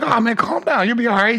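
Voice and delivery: lightly acted, high-pitched reassurance; hyping up. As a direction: imitate a friend hyping up a nervous comic. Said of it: "you're like hyping me up".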